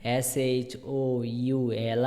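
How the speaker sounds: letters spelled out one by one, very slow; slow spelling. Shown as a demonstration. Teacher spells the silent-L word slowly for students. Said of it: "এটার spelling মানে বানান কিন্তু অন্যরকম".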